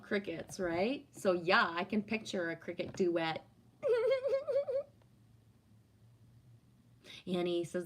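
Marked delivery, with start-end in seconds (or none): 3.8-4.8 high, bursting laugh. Real, not acted. Picturing a cricket duet from chat, laughing. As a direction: let a real laugh break out.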